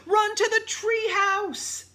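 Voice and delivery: high, loud, drawn-out shout; urgent cry. A narrator's reading. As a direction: yell a desperate order to flee. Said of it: "cried Annie".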